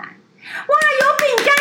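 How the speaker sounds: high, squealing "有饼干" as if thrilled; mock delight. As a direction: mimic greedy glee at the snacks. Mocking greedy colleagues spotting cookies.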